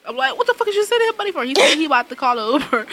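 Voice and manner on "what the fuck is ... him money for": fast outraged question. Retold or imitated. Scolding mom, quoted.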